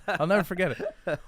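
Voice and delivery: laughing while telling it, high and loud. Real, not acted. Telling a friend about the hospital scare, laughing. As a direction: let a laugh carry the line.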